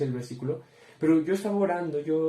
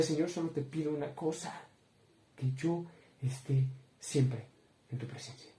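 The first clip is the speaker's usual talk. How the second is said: hushed prayer; 8 dB quieter than usual. Retold or imitated. Student asking God for his presence, fading to whisper. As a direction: pray it barely aloud. Retold prayer before university.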